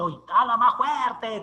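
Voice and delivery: loud, slow call from the crowd; mock call to speak up. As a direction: listener calling out to a mumbling speaker, loud and slow. Audience tells speaker to speak up.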